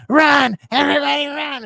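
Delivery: mocking an actress shouting at everyone to flee, high and ratty; raspy movie yell. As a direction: yell it like a raspy action heroine. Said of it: "kinda raspy, kinda r-, ratty voice".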